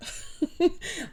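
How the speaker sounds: quiet, high breathy laugh; soft giggle. Real, not acted. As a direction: let out a small stifled laugh. Laughing at repeated comment.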